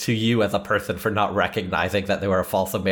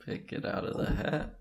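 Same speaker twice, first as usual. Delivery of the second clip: unvoiced whisper, much softer; 7 semitones higher, 10 dB quieter than usual. Real, not acted. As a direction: whisper the cue as a stage aside. Whispered during hat draw.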